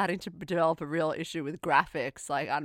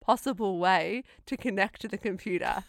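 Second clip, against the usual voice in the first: ordinary talk, nothing marked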